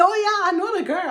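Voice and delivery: high, fast imitation of someone claiming to know her; tossing off a quick, eager claim; mock bragging acquaintance. Viewers in groups telling friends they know her, high and fast. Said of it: "And people were saying".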